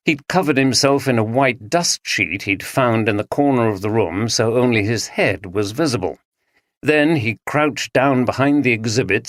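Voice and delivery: ordinary delivery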